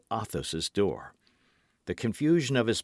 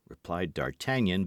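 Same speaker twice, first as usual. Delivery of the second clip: plain reading voice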